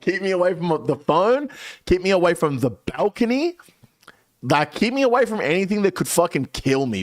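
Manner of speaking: high-pitched retold panic; freaking out. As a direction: plead urgently, pitch up, as if in danger. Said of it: "I'm freaking out".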